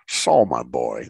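put-on deep, low old mentor's voice; Bill Matthews. Old artist greets his young student, cool deep voice. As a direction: drop way down like a cool old man. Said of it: "That's how he talked. He had the coolest voice".

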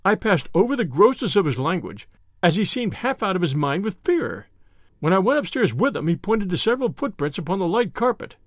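plain reading voice

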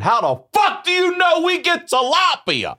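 very high, exasperated mock scream; retold high-pitched outburst; losing it. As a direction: scream it in hammed-up disbelief. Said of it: "I lost my shit".

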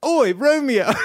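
high, hammed-up cockney British accent; bad British accent. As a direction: overdo a British accent like a shouting Juliet. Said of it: "criticized her British accent".

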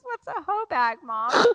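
put-on high innocent kid voice; child asking. Son asking mom in the car, high innocent child voice. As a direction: ask like a puzzled little boy. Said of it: "Levi goes".

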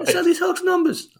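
hammed-up Irish lilt, squeaky; Irish accent. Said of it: "I can do that accent".

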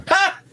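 sudden, very high single "ha" burst; abrupt loud laugh. Shown as a demonstration. Imitating a friend's laugh with no transition, explosive. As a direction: laugh straight to ten. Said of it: "no transition with a laugh".

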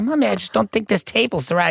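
high whiny mom voice; mock fussy mother; imitating mom. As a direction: fuss about the table, pitched high. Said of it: "She's like".

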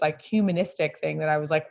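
normal talking voice

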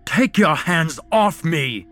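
high, loud bellowed demand. A narrator's reading. Ian, seized by the waiter, bellowing loud and high. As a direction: roar at him to let go. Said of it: "Ian bellowed".

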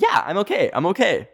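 high, brushing-off yeah, I'm okay; high-pitched insistence that everything is fine; forced reassurance. Retold or imitated. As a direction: pretend to be fine while shaken. Insisting she's fine after the crash.

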